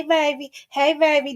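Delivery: usual speaking voice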